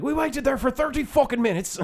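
high-pitched, indignant mock Irish yell; Irish accent yelling. Angry customer turning on the waitress, yelling in an Irish accent. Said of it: "yelling at an, uh, at an, uh, Filipino girl in an Irish accent".

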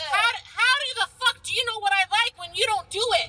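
arguing with a friend who calls the slapper a real man, heated. Real, not acted. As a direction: fire it back at your friend. Heated argument about rough sex.

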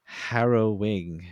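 milk the word like a reveal; drawn out. Pretend. Setting up a pun on the word, drawn out. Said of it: "drawn-out pun setup".

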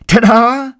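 high, loud showman's ta-da; ta-da reveal. Acted. Preacher revealing Jesus to the congregation, loud and high. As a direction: announce it like a magic trick.

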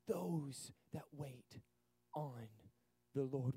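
soft, very slow, low and drawn out; tender whispered scripture; hushed reverence. Shown as a demonstration. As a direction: let each word settle in quiet awe.

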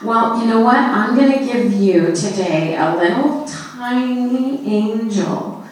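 usual speaking voice

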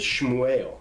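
Shmuel said as "shmoo-EL"; Hebrew pronunciation. Shown as a demonstration. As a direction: say Shmuel the Hebrew way, opening on "shm". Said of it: "Samuel's name in Hebrew is".

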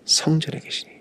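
성전에 whispered with no voice; whispered answer. Shown as a demonstration. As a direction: breathe "성전에 계시니" as a hushed reply. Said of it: "여호와는 어디 계신다고요?". (속삭이듯 답하는 목소리)

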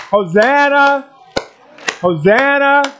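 high, sustained call of praise; bold cry. Shown as a demonstration. Pastor modelling Hosanna for congregation, bold high cry. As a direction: call it out boldly, pitched high. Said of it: "Say it in a loud voice".

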